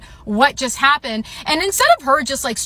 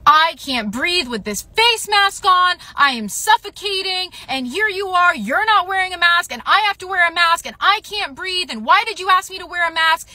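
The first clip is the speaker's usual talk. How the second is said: pitched-up mock rant of an angry masseuse; higher, indignant imitation of her complaining; imitating her rant; 7 semitones higher than usual. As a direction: mimic her going off at you, voice pitched high. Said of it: "she just goes off".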